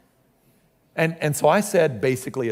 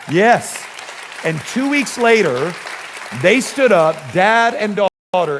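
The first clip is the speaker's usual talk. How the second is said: much higher and louder, triumphant; preaching climax; 6 semitones higher, 6 dB louder, slower than usual. Real, not acted. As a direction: ring it out like the story's payoff. Climax of the testimony.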